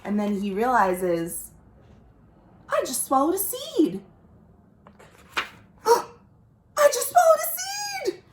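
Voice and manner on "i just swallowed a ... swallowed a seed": panicked croc. Acted. Croc realizing he swallowed a seed, rising into panic.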